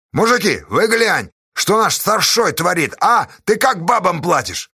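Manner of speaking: high, rousing complaint; full-voiced uproar. A narrator's reading. Pozdey stirring up the men over pay, full-voiced. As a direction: rouse the crowd at full voice. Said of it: "в полный голос шумел Поздей".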